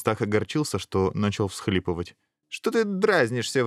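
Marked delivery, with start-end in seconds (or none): 2.5-3.6 high-pitched, mildly indignant challenge. A narrator's reading. Princess rebuking the sobbing prince, indignant. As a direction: object with a touch of offence. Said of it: "возмутилась принцесса".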